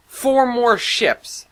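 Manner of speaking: high, loud, slow angry outburst on Four; furious spat words. Acted. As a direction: spit each word in disbelief. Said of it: "I spat".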